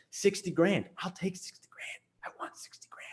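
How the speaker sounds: hushed, dropping almost to nothing; whispered aside. Acted. Creator confides he'd take sixty grand, whispered aside. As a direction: whisper it like a secret wish. Mock aside about the money.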